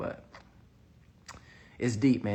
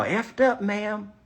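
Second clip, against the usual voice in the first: blunt honest answer; 8 semitones higher, 5 dB louder, slower than usual. Shown as a demonstration.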